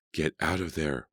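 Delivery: ordinary delivery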